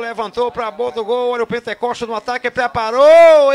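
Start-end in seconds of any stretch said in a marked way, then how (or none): 2.5-3.5 drawn-out, louder and higher; excited long shout. Real, not acted. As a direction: stretch it out as the shot comes. Chance on goal, narrator.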